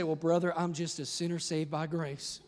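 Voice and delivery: put-on humble churchgoer with a Southern accent. Churchgoer excusing themselves to the pastor, Southern accent. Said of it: "I did the Southern accent".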